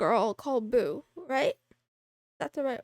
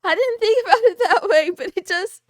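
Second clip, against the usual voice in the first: cracking up, loud and high; can barely get the words out through laughter; real giggling fit; laughing hard; 6 semitones higher, 6 dB louder than usual. Real, not acted. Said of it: "I'm laughing every time".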